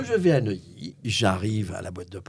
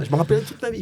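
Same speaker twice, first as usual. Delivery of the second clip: ordinary talk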